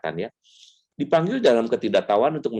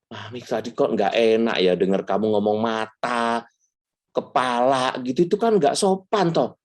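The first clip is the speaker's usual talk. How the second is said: higher-voiced gentle scolding mother; a mother mildly chiding her priest son; mother's reproach; 7 semitones higher than usual. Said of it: "mama saya langsung ngomong gini". (ibu yang menegur dengan lembut)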